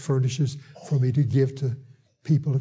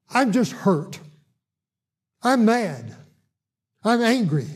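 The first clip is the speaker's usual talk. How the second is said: higher-pitched mock complaint, each phrase falling; retold anger; 7 semitones higher than usual. Paralyzed patient tells preacher his feelings, retold anger. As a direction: imitate an upset man listing his grievances.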